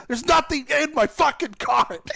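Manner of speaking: high, loud and slow, dragging out the outrage; slow loud complaint at the empty cart; exasperated shout. Retold or imitated. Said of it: "I'm like".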